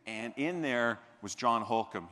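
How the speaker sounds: ordinary talk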